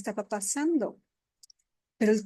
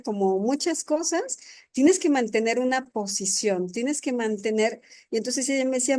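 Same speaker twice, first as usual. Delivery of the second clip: ordinary talk, nothing marked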